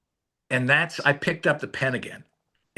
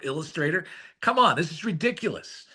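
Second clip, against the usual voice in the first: normal talking voice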